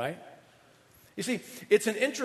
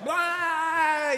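mock outburst; 11 semitones higher than usual. Imitating an emotional outburst.

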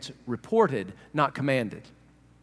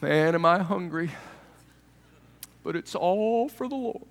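lightly hammed-up pious suffering from hunger; showy suffering faster. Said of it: "and you go like this".

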